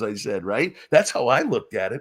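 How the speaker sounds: ordinary talk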